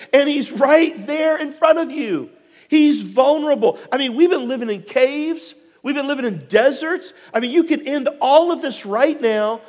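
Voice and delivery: high-pitched, exasperated pleading; incredulous urging. Acted. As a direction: press him hard, as a disbelieving comrade. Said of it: "they basically say".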